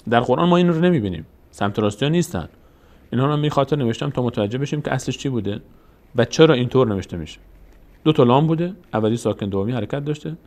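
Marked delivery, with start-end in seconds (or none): none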